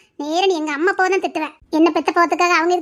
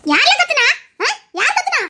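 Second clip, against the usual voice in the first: high-pitched angry retort; indignant yelling; 9 semitones higher than usual. Acted. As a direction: yell back, much higher in pitch. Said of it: "ஏன் முன்னாடி அவளை கத்திட்டு இருக்கீங்க".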